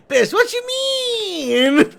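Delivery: laughing mock offense; laughing outburst. Real, not acted. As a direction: squeal it through real laughter. Laughing at friend's teasing.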